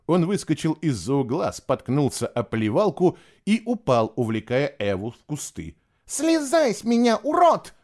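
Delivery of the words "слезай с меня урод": high, loud indignant yell; angry shout. A narrator's reading. Eva pinned in the bushes by Fisher, shouting. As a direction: yell it high, punching the insult. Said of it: "закричала та".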